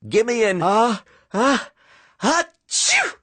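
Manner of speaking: cheerleaders' chant collapsing into a sneeze. Acted. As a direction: cheer breaking into a high sneeze. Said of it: "sneezed the cheerleaders".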